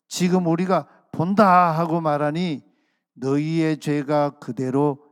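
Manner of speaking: loud, slow, drawn-out verdict; stern pronouncement. Acted. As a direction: proclaim the judgment with weighty force.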